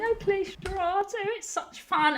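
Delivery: high, airy upper-class lady voice; posh Laura. Acted. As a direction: chirp about parties like a society lady. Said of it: "Posh Laura a question".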